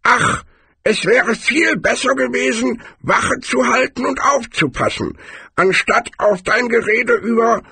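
put-on very high old man's voice, grumbling; complaining old man. Old man regrets not keeping watch, high-pitched grumbling. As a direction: play a high-voiced old codger rueing his mistake.

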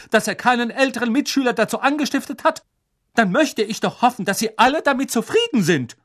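high, loud, fast indignation; indignant. Acted. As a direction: scold in outrage. McGonagall upset.

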